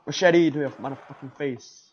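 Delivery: loud, fierce battle yell; aggressive yell. Real, not acted. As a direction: yell it as you swing the machete. Attacking in game.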